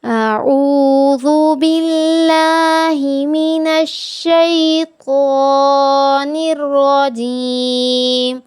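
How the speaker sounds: slow, melodic tajweed recitation; Quran recitation. Shown as a demonstration. Teacher recites for her students with slow melodic tajweed.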